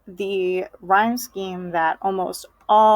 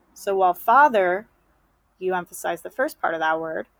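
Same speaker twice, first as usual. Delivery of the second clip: ordinary talk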